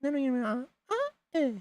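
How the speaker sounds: put-on very high, squeaky anime girl. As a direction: chirp it like a cute anime girl. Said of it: "Like the little anime girl's voice".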